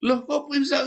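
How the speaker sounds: high, incredulous voice of the woman; a hint of the shocked mother; astonished woman. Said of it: "kata si ibu ini".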